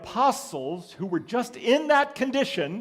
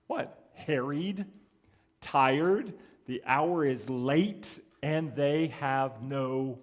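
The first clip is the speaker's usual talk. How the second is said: usual speaking voice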